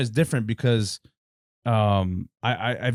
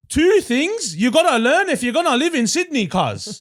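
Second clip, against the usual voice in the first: put-on high, loud Sydney wog accent; 12 semitones higher, 5 dB louder than usual. Imitating a Sydney wog video, loud wog accent. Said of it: "it's like".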